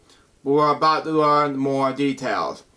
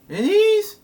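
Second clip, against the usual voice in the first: baffled question. Real, not acted. Puzzled at misspelled word.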